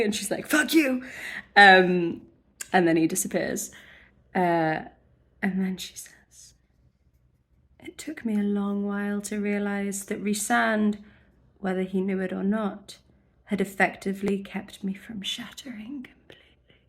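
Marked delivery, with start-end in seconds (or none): 0.5-1.0 indignant retort. Acted. Acting out Feyre's angry retort. 7.8-16.8 soft, measured literary narration; hushed book reading. Acted. Host quoting Feyre's realization about Rhysand, hushed reading. Reads passage from the book aloud.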